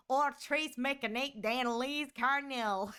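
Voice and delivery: put-on Oratrice Mécanique d'Analyse Cardinal in a drawn Southern accent; a lightly done Southern accent. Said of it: "Southern accent".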